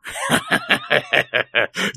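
long, high-pitched burst of laughter; rolling theatrical belly laugh; booming guffaw. A narrator's reading. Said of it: "разразился громким хохотом".

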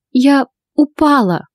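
high, slow, hesitant admission; evasive wife. A narrator's reading. Wife excusing injuries to husband, hesitant. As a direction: offer the excuse haltingly, voice lifted. Wife denies she was beaten.

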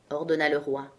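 plain reading voice

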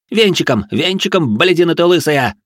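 high, loud abusive scolding; furious chef losing it; angry snarl. A narrator's reading. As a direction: yell at a clumsy pupil. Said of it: "рычал Гринривер на Рэя".

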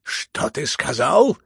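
slow, thunderous challenge; thundering. A narrator's reading. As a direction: thunder the question, drawn out and loud. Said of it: "загремел генерал".

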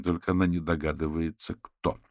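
ordinary delivery, nothing marked

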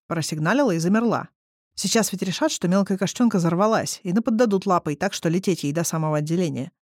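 plain reading voice

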